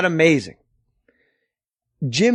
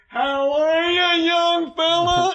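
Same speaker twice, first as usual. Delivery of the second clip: much higher, slow, put-on voice; goofy old-timer greeting; 11 semitones higher, slower than usual. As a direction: drawl it like a hammy old gent. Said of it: "Chris goes".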